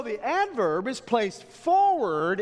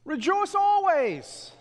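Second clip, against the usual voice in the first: high, loud, slow preaching; "Rejoice always" shouted slowly and high; proclaimed command; 11 semitones higher, 5 dB louder, slower than usual. Acted. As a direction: ring it out slowly from the pulpit. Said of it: "preacher proclaiming the command".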